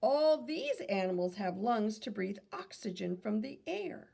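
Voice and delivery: normal talking voice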